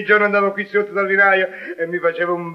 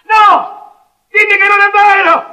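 loud, high horrified cry; 9 semitones higher, 9 dB louder than usual. Acted. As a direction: cry out in disbelief. Husband horrified.

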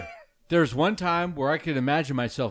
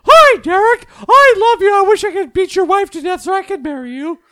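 very high, loud squeaky mock voice; mocking Emma Stone impression; 9 dB louder than usual. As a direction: parody a shrill starlet, high. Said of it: "your impression of Emma Stone was".

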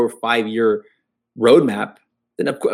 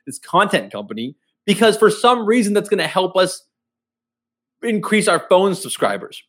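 higher-pitched parody of clueless corporate logic; mock executive pitch; 6 semitones higher than usual. Ranting about a phone company buying content, mocking executive voice. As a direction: ridicule the company by acting out its big plan. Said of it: "was like".

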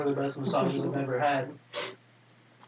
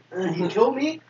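lightly put-on voice of the masseuse; mock warning with a rising end; imitated masseuse. Masseuse warning client about her boss, lightly imitated. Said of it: "That was pretty much what she was saying".

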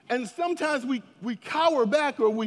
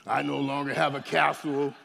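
lower, self-pitying grumble; mopey prince voice; 7 semitones lower than usual. Retold or imitated. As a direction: complain like a prince down on his luck. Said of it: "I bet he doesn't walk around like".

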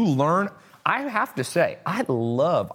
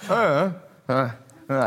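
mumbling mad scientist; 3 semitones higher, slower than usual. Retold or imitated. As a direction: grunt like an absent professor. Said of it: "like, mad scientists".

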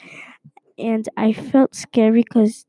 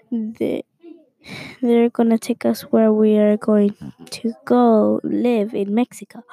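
usual speaking voice

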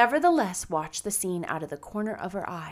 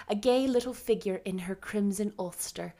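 ordinary delivery

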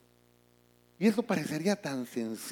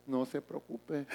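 calm, softer "No se preocupe"; soft reassurance; 2 semitones lower, 9 dB quieter, much slower than usual. Retold or imitated. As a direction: soothe the worried member gently. Pastor answers calmly. (pastor que tranquiliza con suavidad)

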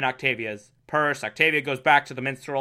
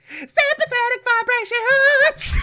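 drawn-out falsetto shriek; high-pitched proclamation; slower than usual. Acted. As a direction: send it way up high and hold the last word. Mock-dramatic naming of the villain's trick.

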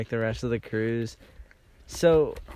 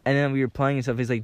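ordinary talk

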